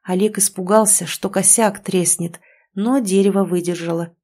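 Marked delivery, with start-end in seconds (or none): none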